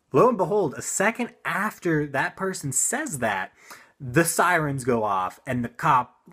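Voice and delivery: ordinary talk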